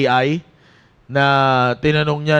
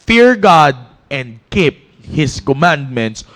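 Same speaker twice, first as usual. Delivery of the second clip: scripture reading; 3 semitones lower, 5 dB louder, much slower than usual. Acted. Quoting the Bible's conclusion.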